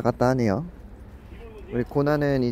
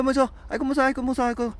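much higher squealing puppy voice; scared puppy voice; 11 semitones higher, much faster than usual. Pretend. Owner voices Budungi too scared to come down, high squeal.

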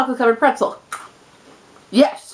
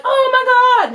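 mock-squealed amazement; re-enacted excitement; 11 semitones higher, slower than usual. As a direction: act out being thrilled, pitched way up. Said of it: "And I'm like".

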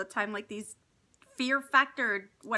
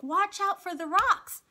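high, slow call of warning; retold kids shouting; 7 semitones higher, 4 dB louder than usual. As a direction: shout the warning from far ahead. Kids calling from ahead.

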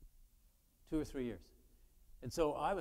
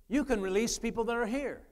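higher and louder, driving the point home; preaching emphasis; 8 semitones higher, 8 dB louder than usual. Shown as a demonstration. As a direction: repeat it with full preacher force. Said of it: "repeated line, preached louder, higher".

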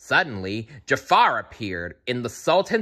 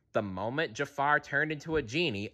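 plain reading voice

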